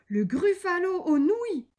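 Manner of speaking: high, loud echo in disbelief; alarmed cry. A narrator's reading. The gruffalo hears he is the dish, alarmed cry. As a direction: cry it out, aghast. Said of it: "cria le gruffalo".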